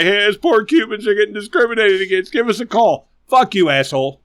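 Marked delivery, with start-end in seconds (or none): 0.0-3.1 higher whiny mimic; mock the crybaby complaining; hammed-up whining kid. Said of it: "wants to bitch and cry".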